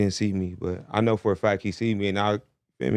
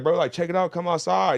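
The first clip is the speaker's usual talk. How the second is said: mild mock yell, pitched higher; retold shout without full volume; retold call-out; 6 semitones higher than usual. Rapper calling a man outside, mild retold yell. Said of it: "I'm screaming at him".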